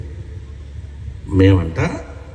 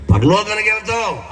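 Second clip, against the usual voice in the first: much higher put-on voice for the husband's answer; mock quoted reply; 10 semitones higher than usual. Husband teasing his wife about going to heaven, high mock voice. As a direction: pitch it up as if playing the other person.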